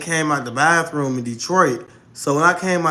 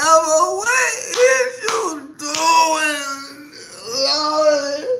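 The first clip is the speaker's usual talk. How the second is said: much higher, very slow wailing cry; anguished outcry; 12 semitones higher, much slower than usual. Retold or imitated. Retelling the moment she lost it, wailing. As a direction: wail the question like it's unbearable.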